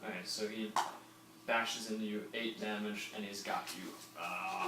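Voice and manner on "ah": high snarling zombie lunge. Acted. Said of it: "I'm biting zombie impression".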